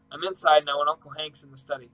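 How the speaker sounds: usual speaking voice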